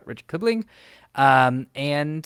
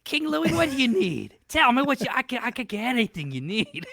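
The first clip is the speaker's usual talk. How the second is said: hammed-up kid offering help; eager Mowgli; 10 semitones higher than usual. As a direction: Mowgli pleading with King Louie, hammed-up.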